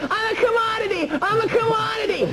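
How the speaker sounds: very high-pitched joking shout; retold yelling. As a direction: re-enact yelling it as a big joke. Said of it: "And I'm yelling".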